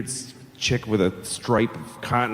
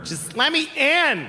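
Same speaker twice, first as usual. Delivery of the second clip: lightly done mock Mexican accent, high pleading at the end; a hammed-up Mexican accent, begging; 14 semitones higher, slower than usual. Border crosser pleads to be let in, mock Mexican accent. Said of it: "offensive stereotype of a Mexican".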